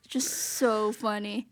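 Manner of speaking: usual speaking voice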